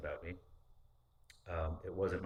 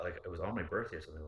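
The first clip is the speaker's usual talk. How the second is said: ordinary delivery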